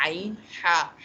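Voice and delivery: आइन, हा said from the middle of the throat; a pressed आइन against a breathy हा; throat sounds demo. Shown as a demonstration. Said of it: "हा हमारे पास centre से आता है".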